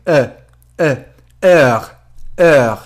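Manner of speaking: اه and ار with a wide-open mouth, like goofing around; mock-silly vowel. Shown as a demonstration. Teacher to students, playful open-mouthed vowel demo. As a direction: open the mouth a bit wider as if clowning. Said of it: "انگار دارید مسخره بازی در میارید".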